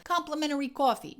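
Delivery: too-strong Chicago accent, punched hard; overdone Al Capone accent. Shown as a demonstration. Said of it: "you're gonna go Al Capone".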